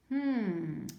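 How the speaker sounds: ordinary talk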